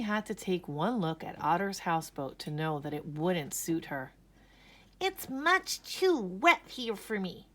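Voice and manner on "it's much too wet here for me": high, slow wailing bunny; higher, slow wail of a fussy rabbit. A narrator's reading. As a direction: wail it out, drawn out and miserable. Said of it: "she wailed".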